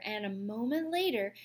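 ordinary delivery

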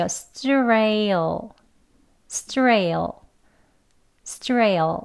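strail sounded out slowly; syllable demo. Shown as a demonstration. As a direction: strail repeated as a model sound. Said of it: "이렇게 됩니다".

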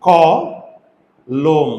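ক, then লোম, with a clear pause between; split into syllables. Shown as a demonstration. Teacher shows two syllables, ক and লোম said apart. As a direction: break it into two beats: ক … লোম. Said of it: "syllable আছে কয়টা জানো".

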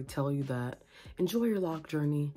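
usual speaking voice

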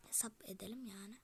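soft whisper of "Hesap edelim"; whispered "Hesap edelim yani"; whispered repeat. Shown as a demonstration. Repeats the line, then whispers it.

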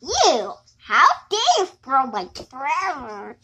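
ordinary talk

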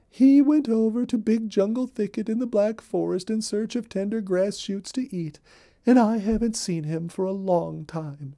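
a busy little rabbit spilling all the news; Molly Cottontail. Acted. Molly voiced in read-aloud story.

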